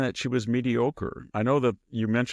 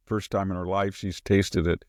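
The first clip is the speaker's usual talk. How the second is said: normal talking voice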